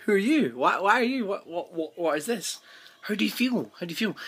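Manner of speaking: mock-eager barrage of questions; eager questioning. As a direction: pepper them with wide-eyed questions. Clara quizzing everyone she meets.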